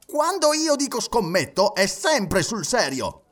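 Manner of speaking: high-pitched, emphatic, slowing at the end; heated retort. A narrator's reading. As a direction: retort with indignant insistence. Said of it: "replicò Andrew Stewart". (replica infervorata)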